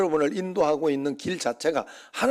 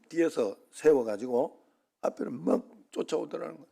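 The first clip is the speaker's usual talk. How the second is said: ordinary talk, nothing marked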